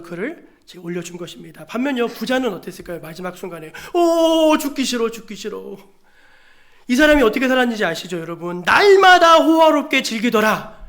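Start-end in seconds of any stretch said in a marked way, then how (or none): 3.9-5.8 loud high wail that fades away; hammed-up reluctant wail, sinking; dying man's lament. Acts the rich man dying. 8.6-10.8 loud, high, drawn-out proclamation; ringing preacher's declamation; emphatic declaiming. Real, not acted. Declaims how the rich man lived.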